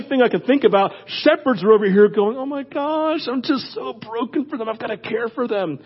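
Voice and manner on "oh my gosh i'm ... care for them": soft heartbroken. Acted. Shepherd voiced, heartbroken for people.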